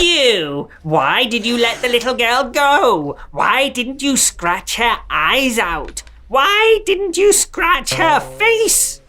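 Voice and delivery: high, slow shrieking witch scolding; yelling witch. A narrator's reading. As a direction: scold the cat like a furious witch.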